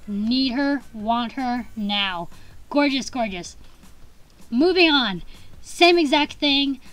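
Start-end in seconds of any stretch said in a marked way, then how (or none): none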